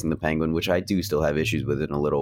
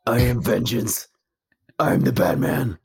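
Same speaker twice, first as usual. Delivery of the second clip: gravelly Batman monologue; 3 semitones higher, slower than usual. Retold or imitated. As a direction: growl it like a brooding vigilante. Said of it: "it was like".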